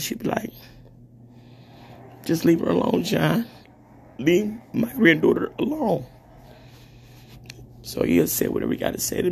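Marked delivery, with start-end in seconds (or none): 2.3-6.0 imitating grandmother. Grandmother voiced, defending her.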